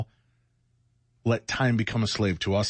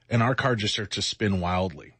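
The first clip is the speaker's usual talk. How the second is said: ordinary talk, nothing marked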